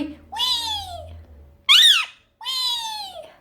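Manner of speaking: very high squeaky wee; wee sound. Shown as a demonstration. Owner showing the parrot its wee sound, very high squeak. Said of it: "do you want to say wee one more time".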